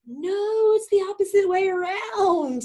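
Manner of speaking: very high, drawn-out exclamation; emphatic correction. Real, not acted. Teacher correcting the student's whole view, emphatic cry. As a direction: cry it out to overturn their idea.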